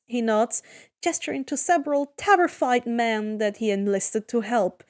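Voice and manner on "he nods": usual speaking voice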